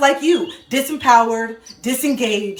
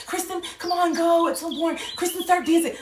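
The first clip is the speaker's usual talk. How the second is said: higher, coaxing voice of the relatives; imitating relatives urging her onto the dance floor; mock pushy relatives; 7 semitones higher, 3 dB quieter than usual. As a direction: egg her on to dance like family at a wedding.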